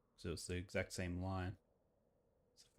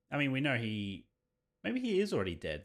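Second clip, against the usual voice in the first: raised excited voice; 4 semitones higher, 8 dB louder than usual. Acted. Reading game dialogue aloud.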